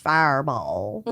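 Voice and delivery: low, slow FIREBALL; drawn-out mock growl. Host playing on the fireball burst, low drawn-out voice.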